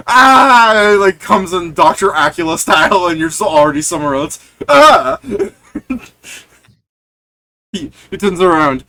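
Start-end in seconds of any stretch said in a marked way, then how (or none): 0.1-1.0 loud high shriek as it bites; vampire shriek. Acted. Vampire bite scream. 4.7-5.2 high shrieking cry; loud shriek. Acted. Vampire attacks, shouting.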